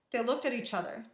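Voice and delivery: usual speaking voice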